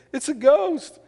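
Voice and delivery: high, loud, drawn-out cry of alarm; fearful cry. A narrator's reading. Frightened disciples in the boat see him on the sea, fearful cry. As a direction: cry out at the sight of a ghost. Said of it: "they cried out for fear".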